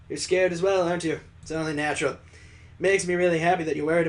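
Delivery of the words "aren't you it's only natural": ordinary delivery, nothing marked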